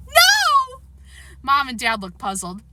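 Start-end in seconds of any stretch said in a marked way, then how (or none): 0.1-0.8 crying scream. A narrator's reading. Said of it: "Fudge screamed through his tears".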